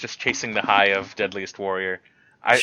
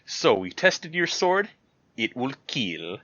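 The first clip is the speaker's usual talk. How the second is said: imitating the show host; 3 dB quieter, slower than usual. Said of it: "I don't know why he says it like that".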